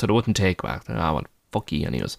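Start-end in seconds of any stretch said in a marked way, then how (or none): 1.5-2.2 muttered curse. Retold or imitated. Retold angry thought.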